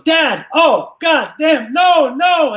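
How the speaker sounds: high, loud cry of dismay; horrified cry. Acted. Tommy bursting in on Daddy and Mommy, horrified cry. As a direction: wail "no" in shock, high and drawn out. Son catches parents in a joke.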